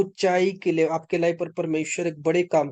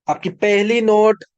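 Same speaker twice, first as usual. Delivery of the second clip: higher, louder insistence on "आपकी पहली note"; emphatic repeat; 5 semitones higher, 6 dB louder than usual. Real, not acted. Preacher telling listeners which note to check, loud and emphatic.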